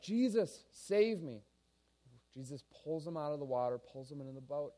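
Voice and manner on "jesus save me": higher-pitched urgent plea, less than a scream; pleading cry. Acted. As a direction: reach out for rescue with your voice. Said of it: "And he reaches out".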